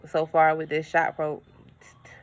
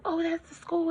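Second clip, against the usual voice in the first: whisper; 11 semitones higher, 6 dB quieter than usual. Retold or imitated. Whispered aside in mimicry.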